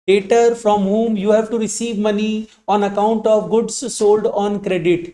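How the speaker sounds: very slow, word by word, for writing down; slow dictation. Shown as a demonstration. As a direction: dictate it slowly so students can copy. Teacher dictates definition to class. (धीमे-धीमे लिखवाना)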